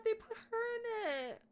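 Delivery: high, voice breaking with happy tears; crying with real emotion; touched crying. Real, not acted. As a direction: choke up with joy. Moved by the statue reveal.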